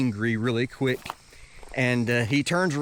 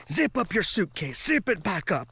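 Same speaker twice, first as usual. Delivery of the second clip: higher-pitched insistent command; imitated urgent order; 6 semitones higher than usual. Guard orders traveler to repack suitcase, imitated urgent order.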